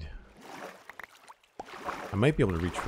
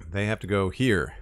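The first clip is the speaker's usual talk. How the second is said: firm, matter-of-fact declaration; assertive; 5 dB louder than usual. Acted. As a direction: say it with confidence, no doubt. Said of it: "he said assertively".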